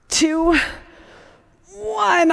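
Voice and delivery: high, strained counting; straining while rowing. Real, not acted. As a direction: push the count out through effort. Counting her own hard reps.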